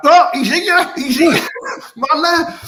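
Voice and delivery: loud, high burst of excitement; excited. Real, not acted. Host spotting his missing co-host back on the show, excited. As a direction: call it out, pitched right up.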